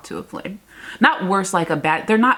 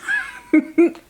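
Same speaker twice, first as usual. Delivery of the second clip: helpless laugh; 12 semitones higher, 4 dB louder than usual. Real, not acted. Laughs at own complaint.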